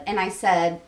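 usual speaking voice

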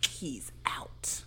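soft, low whisper; hushed aside. Real, not acted. Co-host declaring Steve out of the game, hushed whisper. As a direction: whisper it as a grim verdict.

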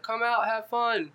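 high, slow, stretched-out call; imitated far-away holler; distant calling out. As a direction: call it across the water, mock cheerful. Mishearing distant screams from shore.

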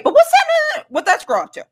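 sudden high-pitched prying question; mock suspicion. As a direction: jump up high as if casually fishing. Acting out suspicion about the girl.

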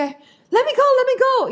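imitating a frightened kid begging to be let out; scared kid pleading. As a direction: beg like a trapped child. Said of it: "había otro de que".

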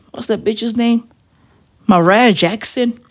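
usual speaking voice

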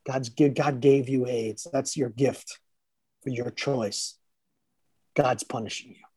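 retold cruel father line. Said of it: "And I said things like".

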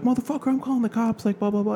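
high-pitched retold threat; imitated angry mom. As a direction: act out mom yelling, without extra volume.

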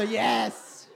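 inner cheer. Retold or imitated. Interpreter copying the preacher's inward relief, triumphant cheer. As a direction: echo the victory cheer with relish. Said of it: "Dentro di me ho detto".

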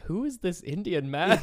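high, drawn-out astonished question; stunned disbelief, played up; retold shock. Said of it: "She's like".

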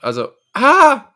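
sudden loud pained yelp; cry of pain. Real, not acted. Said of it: "Ich hab mir grad weh gemacht".

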